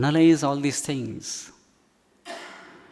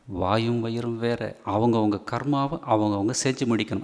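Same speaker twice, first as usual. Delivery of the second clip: usual speaking voice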